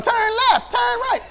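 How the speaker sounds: high, shouted mimic of the GPS; child copying GPS. Daughter mimicking the GPS from the back seat. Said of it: "Wisdom started going".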